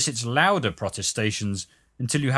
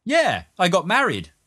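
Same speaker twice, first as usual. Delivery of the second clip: high, emphatic announcement; insistent bumpster; 7 semitones higher, 4 dB louder than usual. A narrator's reading. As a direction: pitch it up, insisting. Bumpster insists on his wedding.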